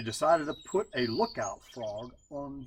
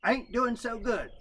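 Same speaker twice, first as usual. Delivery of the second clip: gloomy raccoon; 9 semitones higher than usual. A narrator's reading. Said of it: "said Brother Coon".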